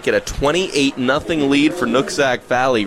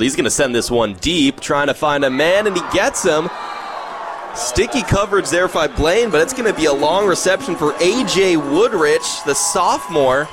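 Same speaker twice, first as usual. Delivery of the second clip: big-play call, voice raised high in pitch; excited play-by-play; 6 semitones higher than usual. Real, not acted. Live call of a long completion.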